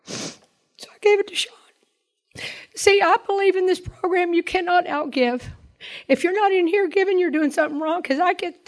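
high, strained voice on the edge of crying; fighting back tears while speaking; tearful. Real, not acted. Telling the group she gave away his car, near tears. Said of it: "I knew I didn't wanna cry".

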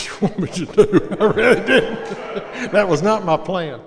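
laughing through the words, voice jumping up; real laughter at his own surprise. Real, not acted. Speaker laughing as he tells the audience what slipped out.

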